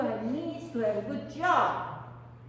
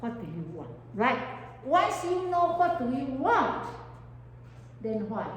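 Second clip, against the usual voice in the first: normal talking voice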